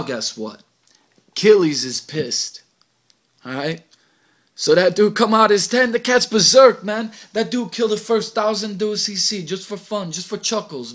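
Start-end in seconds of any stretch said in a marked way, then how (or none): 1.3-2.6 slow, much louder, punched out; loud emphatic. A narrator's reading. Narrator announcing Achilles' rage, loud and slow. 4.5-10.9 higher and much louder, building frenzy; excited loud narration. A narrator's reading. Narrator describing Achilles' rampage, loud and excited.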